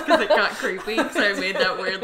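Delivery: loud laughing talk. Real, not acted. Laughing about making the haircut moment weird, loudly. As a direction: speak through a big laugh.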